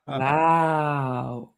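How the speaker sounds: drawn-out, low mock parrot wow; slow parrot wow. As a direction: stretch out wow slowly, low and wondering. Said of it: "Really slowly".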